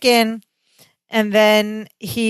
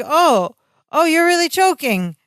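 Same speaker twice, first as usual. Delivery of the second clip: sudden realization; 8 semitones higher than usual. Retold or imitated. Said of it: "his accent is gone".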